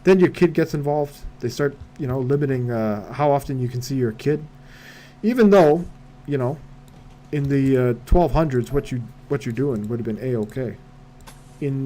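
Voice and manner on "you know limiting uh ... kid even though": usual speaking voice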